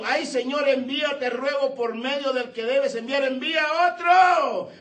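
pleading Moses. Acted.

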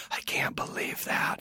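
retold whisper of amazement; breath-only whisper, slow and incredulous; hushed disbelief. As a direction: whisper it, stunned at yourself. Retold amazement after the show.

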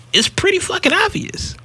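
squeaky high whisper of disbelief; high-pitched voice. Real, not acted. Blurting to cohosts in a high disbelieving voice. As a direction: pitch it way up, breathy and incredulous. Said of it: "I hate using my high-pitched voice".

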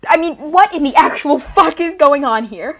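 loud, exasperated yell; exasperated outburst. Acted. Student yelling at the chaos on the tour. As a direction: shout it, totally fed up.

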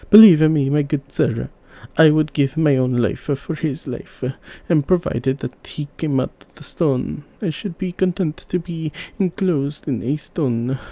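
a king swearing he would die for his brother; earnest king. A narrator's reading. King pledges his own life.

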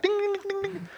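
high, sung-out ding ding imitation; keypad dings. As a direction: chime like old phone keys. Mimicking phone keypad tones.

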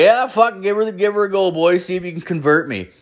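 high-pitched egging them on; cocky drunken invitation. Retold or imitated. Drunk host daring missionaries to convert him, cocky and high.

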